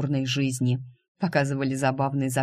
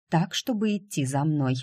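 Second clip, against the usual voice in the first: usual speaking voice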